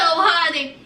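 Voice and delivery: ordinary talk, nothing marked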